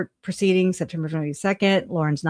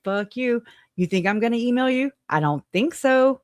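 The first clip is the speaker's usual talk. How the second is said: higher-pitched, indignant comeback; defiant retort; 5 semitones higher than usual. Acted. Telling Trisha she won't email her, defiant retort. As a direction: fire back, refusing to be played.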